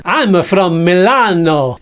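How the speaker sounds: hint of Italian, slow puppet; mock Italian. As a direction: claim Milan in fake Italian. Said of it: "you're not Italian".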